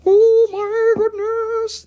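very high, slow amazed squeal; falsetto squeal. Shown as a demonstration. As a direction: top the build with a shriek of delight.

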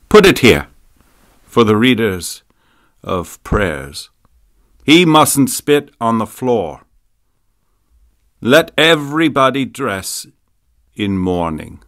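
ordinary delivery, nothing marked

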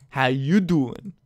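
slow, drawn-out flirty "How you doing?"; a light imitation of Joey's pickup line, slow; Joey Tribbiani line. Shown as a demonstration. Gamer greeting a Pokemon like Joey Tribbiani, slow and flirty. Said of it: "Bringing out my, uh, inner Joey Tribbiani".

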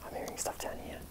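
barely a breath of whisper. Real, not acted. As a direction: whisper so nothing hears you. Whispering at noises.

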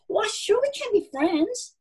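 high, eager animal voice; cheery, high-pitched reassurance; friendly stranger. A narrator's reading. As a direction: pipe up brightly to offer friendship. New animal offers friendship to mouse.

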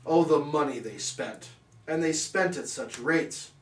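usual speaking voice

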